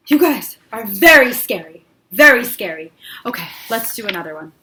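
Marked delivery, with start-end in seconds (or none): none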